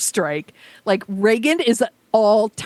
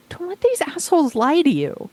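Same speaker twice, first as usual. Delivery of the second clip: 1 exasperated plea; 11 semitones higher than usual. Real, not acted. Pleading with voters, exasperated.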